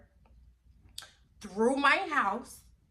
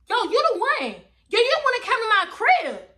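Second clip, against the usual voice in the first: higher, loud calling her out; confronting the girl who broke into her home; loud accusation; 10 semitones higher, 7 dB louder than usual. Retold or imitated. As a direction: point at her and yell.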